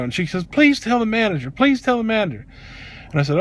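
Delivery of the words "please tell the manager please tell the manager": high-pitched, repeated pleading; imitated emotional plea. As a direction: imitate her begging, voice lifted. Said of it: "She gets even more emotional".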